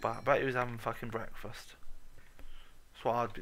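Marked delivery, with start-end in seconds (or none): none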